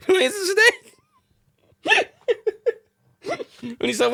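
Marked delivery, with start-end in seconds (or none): 0.1-2.1 high, louder laughing voice. Real, not acted. Quoting themselves with Steve, laughing high. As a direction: squeal it through laughter.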